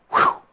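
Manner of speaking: breathy exhaled "whew"; acted sigh of release; whew of relief. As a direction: let out a wordless whoosh of breath. Relief after trusting yourself.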